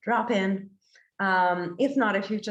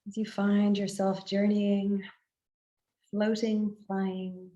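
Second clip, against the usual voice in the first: guided meditation; 4 dB quieter than usual. Acted. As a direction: guide listeners gently inward, phrase by unhurried phrase.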